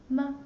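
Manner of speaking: "ma" short and light, no contour. Shown as a demonstration. Teacher showing the neutral tone, "ma" short and light. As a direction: say "ma" quick and unstressed. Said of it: "the light tone".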